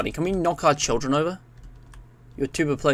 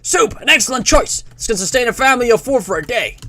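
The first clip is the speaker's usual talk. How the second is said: high, punchy American announcer; hammed-up Cold War American voice; American accent; 8 semitones higher, 4 dB louder than usual. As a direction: sell it like American propaganda. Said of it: "like, an American voice".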